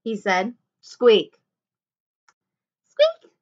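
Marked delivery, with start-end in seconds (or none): 0.8-1.4 half-hearted squeak in your own pitch; unwilling, unmouselike squeak; reluctant squeak. A narrator's reading. Jack reluctantly squeaks as told. 2.9-3.3 very high, loud little mouse squeak; high-pitched playful squeak. A narrator's reading. Annie gladly squeaks like a mouse.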